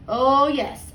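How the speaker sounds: ordinary delivery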